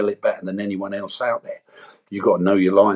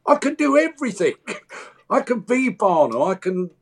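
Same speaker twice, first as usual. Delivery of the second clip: much higher, pushing to prove himself; inner child's defiant list; 10 semitones higher than usual. Retold or imitated. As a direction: list it like a kid begging to be believed. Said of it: "I'm shouting out to everyone".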